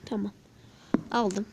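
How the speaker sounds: ordinary delivery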